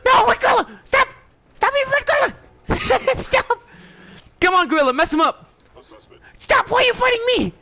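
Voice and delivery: high, loud protest with a laugh breaking in; alarmed shouting. Real, not acted. Player begging his gorilla to stop attacking, alarmed yelling. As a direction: shout in frantic disbelief.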